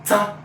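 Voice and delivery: TA with a crisp T, not D; t sound. Shown as a demonstration. Teacher explaining E D endings, TA with crisp T. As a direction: release TA as a voiceless t. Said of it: "and not D".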